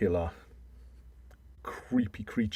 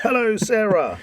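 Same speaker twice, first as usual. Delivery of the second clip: higher, louder put-on hello; theatrical greeting; 12 semitones higher, 10 dB louder than usual. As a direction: greet her like a stage villain.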